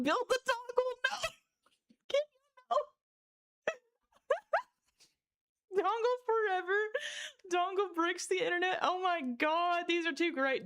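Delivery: high, squeaky voice overtaken by laughter; laughing too much. Real, not acted. Streamer reading out chat's dongle names, laughing too much. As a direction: squeal the words through real hysterics. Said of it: "making me laugh too damn much".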